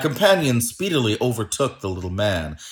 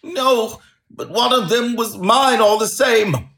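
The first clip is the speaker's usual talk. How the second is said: high, loud whining protest; 12 semitones higher, 5 dB louder than usual. A narrator's reading. Little man claiming his goose from the salesman, whining. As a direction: whine in a small man's high voice. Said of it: "whined the little man".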